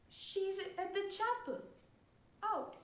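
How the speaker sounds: put-on high, soft child's answer; timid little boy. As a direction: answer your father meekly in a small high voice.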